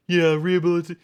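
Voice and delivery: high, prissy parody of a smug pundit; mock the TV host as an out-of-touch snob; mocking Anna voice. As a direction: sneer it in a whiny put-on voice. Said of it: "and you're like".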